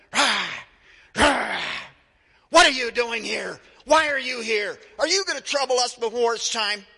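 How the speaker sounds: loud, high demonic roars; demon growls. Pretend. Preacher acts out demons shouting at disciples, loud roaring. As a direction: roar like a possessed man.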